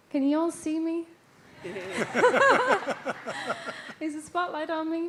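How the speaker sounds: ordinary talk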